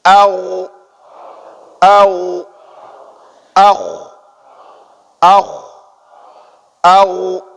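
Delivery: au voiced, akh rough, alternating; gha and kha drill. Shown as a demonstration. Teacher alternating both letters, voiced au then raspy akh.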